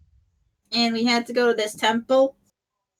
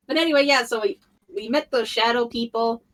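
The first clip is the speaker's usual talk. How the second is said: ordinary talk, nothing marked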